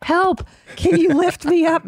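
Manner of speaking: high, pleading damsel voice; damsel in distress. Pretend. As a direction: beg to be rescued, hammed-up. Teasing, playing trapped damsel.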